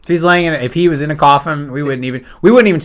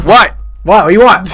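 put-on loud, higher startled old dad; mock irritated shout; 6 semitones higher, 6 dB louder, slower than usual. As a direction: snap awake yelling. Acts startled old dad answering son.

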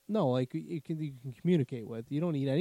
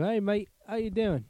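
high-pitched mock Australian accent; Australian kangaroo; 8 semitones higher than usual. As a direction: greet like a chummy cartoon Australian kangaroo. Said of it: "say you're an Australian".

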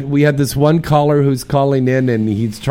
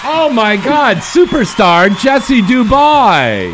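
loud, higher, slow starstruck gush; mock starstruck hype; 10 semitones higher, 5 dB louder, slower than usual. Host greeting caller as superstar, loud mock-starstruck gush. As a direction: gush over her like a superstar arrived.

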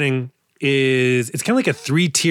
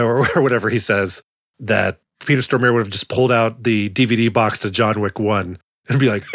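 ordinary talk, nothing marked

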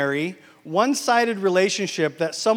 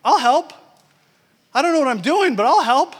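high, loud, eager offer to help; eager volunteering; 8 semitones higher, 8 dB louder than usual. Retold or imitated. Pastor volunteering for the technology team, high and loud eager. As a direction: throw your hand up keen to help.

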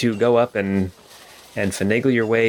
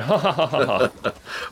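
raised, open "ha-ha-ha" laugh; guard's chuckle; 5 semitones higher than usual. Acted. Gate guard to a stranger at Eberly Manor, self-mocking laugh. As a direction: laugh it out in character, a little high. Guard jokes about his lowly post.

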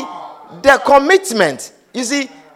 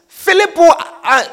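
usual speaking voice

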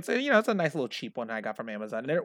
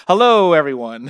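loud greeting; 3 semitones higher, 12 dB louder than usual. Real, not acted. Greeting foreign listeners loudly.

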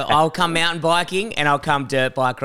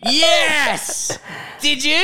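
excited laughing yell; 11 semitones higher, much slower than usual. Real, not acted. Excited reaction to the story.

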